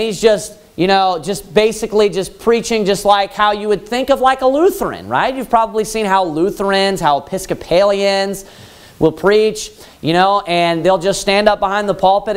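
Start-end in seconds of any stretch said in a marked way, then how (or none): none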